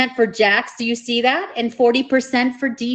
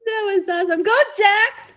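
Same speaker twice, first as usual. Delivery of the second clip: excited; 9 semitones higher than usual. Real, not acted. Host cheers for the match.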